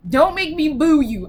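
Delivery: usual speaking voice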